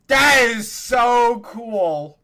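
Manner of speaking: slow, loud, high amazement; drawn-out awe; amazed. Real, not acted. Amazed at the notification.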